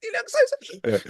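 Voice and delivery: high, slow mocking pity. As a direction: coo at the crowd like they're babies. Mock comedian addressing sad crowd.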